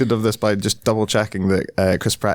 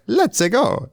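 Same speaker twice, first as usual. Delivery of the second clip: high, bright-eyed Mario cheer, much higher; 11 semitones higher than usual. Retold or imitated. Mocking Pratt's flat Mario by doing the real cheer. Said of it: "instead of it being like".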